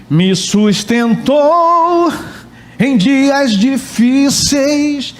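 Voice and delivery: slow, drawn-out tearful words, only mimicked; imitating her crying. Pastor mimics a church sister who cried reading the verse, mock tearful. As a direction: mimic a sister choking up mid-verse, very slowly. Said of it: "Ela começou a chorar".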